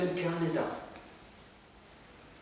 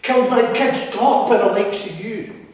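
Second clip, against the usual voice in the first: anguished shout; 5 semitones higher, 11 dB louder than usual. Acted. Telling the audience how he was killed, an anguished shout. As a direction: spit it out in grief and rage.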